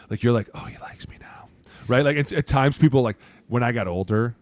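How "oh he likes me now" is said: whisper. Retold or imitated. Backup player's private thought.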